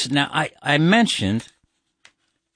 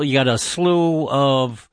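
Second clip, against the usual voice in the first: normal talking voice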